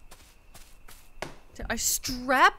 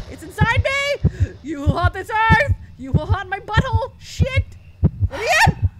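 loud high mock panic; hammed-up possession; 8 semitones higher, 9 dB louder than usual. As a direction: ham up being possessed.

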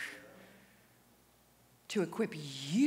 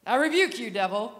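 a loud, forceful command; loud rebuke; 3 semitones higher, 12 dB louder than usual. Shown as a demonstration. Showing the crowd the loud way he did not pray, booming. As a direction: bark it at the devil. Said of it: "Not like that".

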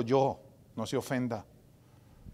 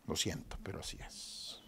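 whisper; 10 semitones lower, 15 dB quieter than usual. Real, not acted. Quiet aside about mothers.

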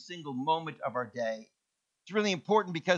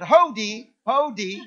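hodi called out much louder, each one punched; loud calling; 4 semitones higher, 8 dB louder, slower than usual. Retold or imitated. Visitor calling at the hut, much louder. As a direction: shout hodi toward the door. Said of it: "start yelling".

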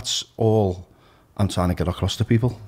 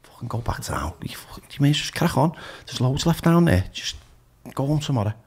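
whispered then higher tempting voice; addictive voice; 5 semitones higher than usual. Retold or imitated. Addictive voice in the mirror urging him on, tempting whisper. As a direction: coax him back to the party. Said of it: "one going".

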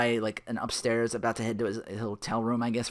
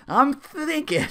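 much higher, smug drawn-out reply; cheeky stall; 7 dB louder, much slower than usual. Retold or imitated. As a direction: act like you're pondering, teasing. Phil stalling the cop.

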